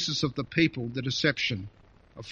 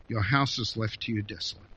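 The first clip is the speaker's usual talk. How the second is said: "your house is left to you desolate" said lower and softer, mournful; grieving hush; 5 semitones lower, 4 dB quieter than usual. Shown as a demonstration. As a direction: let the desolation fall quietly in sorrow. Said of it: "Do you see both the judgment and the pain".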